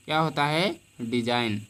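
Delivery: usual speaking voice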